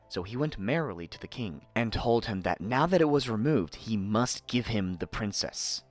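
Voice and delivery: plain reading voice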